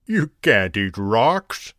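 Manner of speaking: slow, croaky-voiced frog with a light laugh. A narrator's reading. Frog teasing Bonnie from his log, slow mock laugh. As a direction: stretch it out like an amused frog. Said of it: "laughed the frog".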